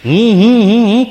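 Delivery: mocking "I, I". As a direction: jeer at the self-obsessed. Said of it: "કર્યા કરે છે".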